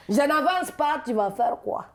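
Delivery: put-on defiant Ivorian accent, loud high start; exuberant Ivorian accent. Ivorian woman stands up to Parisian, exuberant Ivorian accent. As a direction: challenge him with Ivorian temperament. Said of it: "elles sont très exubérantes".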